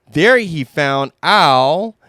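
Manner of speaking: ordinary delivery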